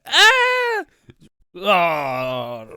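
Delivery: a piercing, very high, loud mock shriek; mock scream. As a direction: shriek in hammed-up terror as the swing comes. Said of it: "he's like".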